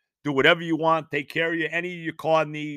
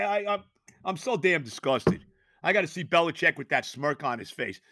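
usual speaking voice

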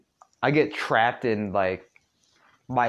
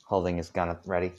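ordinary delivery, nothing marked

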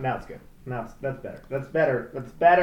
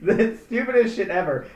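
ordinary delivery

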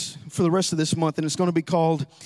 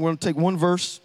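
usual speaking voice

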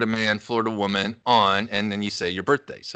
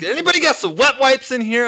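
calling out; 12 semitones higher, 4 dB louder than usual. Retold or imitated. Calling out from bathroom.

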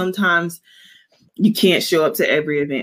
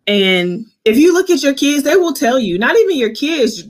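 ordinary talk, nothing marked

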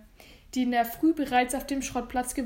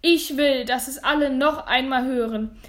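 forceful, projected insistence; loud, determined proclamation; loud public declaration; 6 dB louder than usual. Acted. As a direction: make everyone in the crowd hear. Boy addresses the whole crowd.

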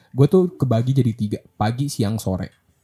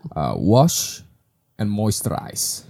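slow commercial voice; 4 dB quieter, much slower than usual. Pretend. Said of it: "selalu begini nih gua".

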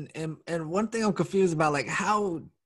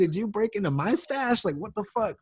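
mock cop interrogation; 7 semitones higher than usual. Friend imitating cops questioning a driver, high and mocking. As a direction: play the suspicious cop grilling a driver. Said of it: "it's like".

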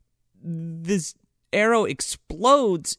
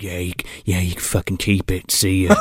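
low, offhand character voice; deadpan it, pitched way down; dead-serious McConaughey; 8 semitones lower than usual. Retold or imitated. Co-host imitating McConaughey brushing off Bale, low deadpan. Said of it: "he's, like, dead serious".

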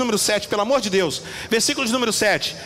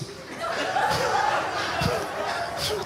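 high breathy burst of laughter; laughing; 6 dB quieter than usual. Real, not acted. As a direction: laugh at his own joke. Preacher laughs at own joke.

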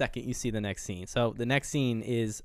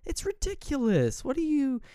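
much higher, mocking put-on voice; scoffing husband. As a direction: scoff at her worry. Husband brushes off wife.

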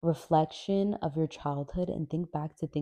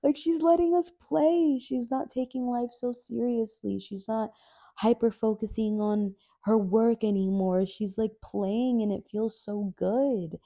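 put-on much higher childlike voice, louder at first then settling; a gleeful little kid finally let out to play; inner child voice; 7 semitones higher, 3 dB louder than usual. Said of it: "my inner child just being like".

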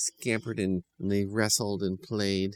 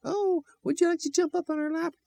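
put-on sweet children's voice. A narrator's reading. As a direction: coo at the puppy like a small child. Children invite puppy onto lap.

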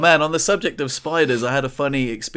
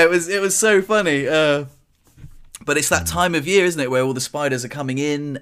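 ordinary delivery

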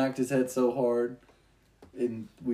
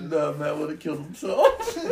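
raised pitch, tipping into laughter; laughing; 4 semitones higher, 4 dB louder than usual. Real, not acted. Friend joking about the childhood prank, laughing. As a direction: start cracking up as you say it.

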